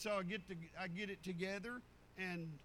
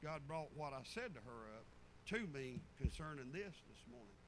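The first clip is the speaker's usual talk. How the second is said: usual speaking voice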